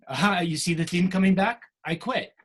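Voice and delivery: ordinary talk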